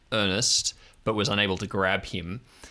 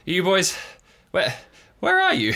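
calling out; 9 semitones higher than usual. Acted. A trapped Bernie calling out to the boys. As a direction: call for the boys across the wreckage.

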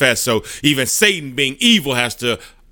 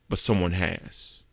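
much softer and lower, slow; quiet; 6 semitones lower, 9 dB quieter than usual. Acted. Jesus kneeling before the Father, hushed and slow. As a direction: let it land in a hush.

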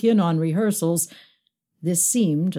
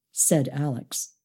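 ordinary delivery, nothing marked

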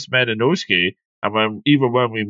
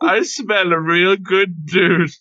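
put-on higher, slow, slurred drunk buddy; mock drunk drawl; 7 semitones higher, slower than usual. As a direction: stretch it out like a tipsy pal at the pub. Mocking a character's line.

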